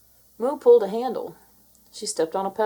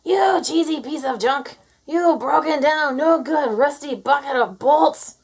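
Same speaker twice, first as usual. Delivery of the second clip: farmer's angry shout; 6 semitones higher, 6 dB louder than usual. A narrator's reading. Minnie yelling the farmer's curse at the tractor. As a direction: bellow like a fed-up farmer. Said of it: "Minnie shouted".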